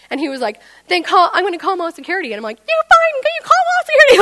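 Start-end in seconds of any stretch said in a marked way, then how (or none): 0.9-2.3 fast, raised, loud threat; imitated yelling manager. Manager threatening the customer with security, fast raised yelling. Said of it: "he's yelling at me". 2.6-4.2 very high, loud shrieking taunt; hysterical mock defiance. Freaked-out customer taunting the manager back, hysterical high shriek.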